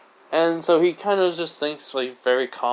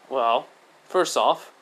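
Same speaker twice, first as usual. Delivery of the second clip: ordinary delivery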